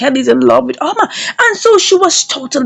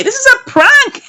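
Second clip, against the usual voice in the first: incredulous; 12 semitones higher than usual. Retold or imitated. Woman suspects a prank.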